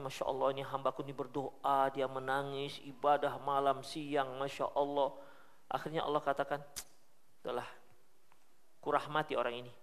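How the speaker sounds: soft and hushed, full of pity; tender, merciful voice. Acted. Allah moved by his weeping servant, soft and tender. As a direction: speak gently, as if moved by a devoted servant.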